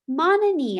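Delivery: put-on girl calling out to her otter. A narrator's reading. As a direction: call the otter's name from the reef. Narrator calls to the otter.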